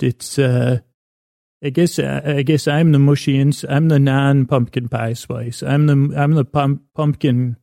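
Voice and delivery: ordinary delivery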